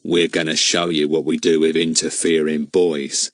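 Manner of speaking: put-on cold threat to a boy; the man in back threatens Ern, low and nasty. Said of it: "in a nasty sort of voice".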